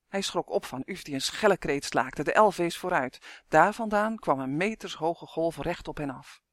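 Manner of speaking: ordinary delivery, nothing marked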